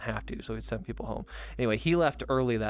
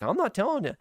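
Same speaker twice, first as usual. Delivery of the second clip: much higher, sing-song tease; teasing refusal; 11 semitones higher than usual. Retold or imitated. Refusing to spoil the show for coworker, high sing-song tease. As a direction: refuse playfully.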